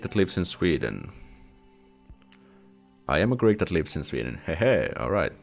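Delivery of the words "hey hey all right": ordinary talk, nothing marked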